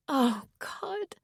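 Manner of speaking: high, crying "Oh, God"; a pained, half-crying groan; dismayed groan. Acted. Woman overwhelmed by the former priest's confession, half crying. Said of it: "resting her head in her hand".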